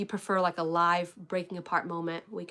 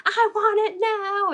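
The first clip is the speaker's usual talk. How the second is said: put-on much higher, louder, slow whiny child wail; dad mock tantrum; 13 semitones higher, 7 dB louder, slower than usual. Dad mimicking his daughter's tantrum in the store, hammed-up whine. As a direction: ham up a toddler's demand. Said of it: "he starts tantruming".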